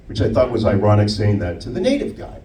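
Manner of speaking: usual speaking voice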